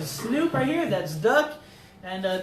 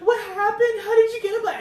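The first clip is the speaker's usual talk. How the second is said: high, loud impression of a panicked mother; alarmed Mexican mom; 12 semitones higher, 6 dB louder than usual. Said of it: "I gave her a Mexican accent".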